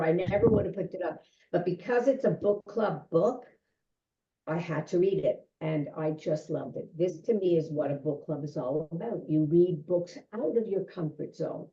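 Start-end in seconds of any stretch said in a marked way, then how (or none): none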